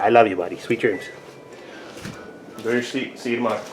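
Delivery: low, soft bedtime voice; quiet, loving sign-off; tender goodnight. Real, not acted. Saying goodnight to child.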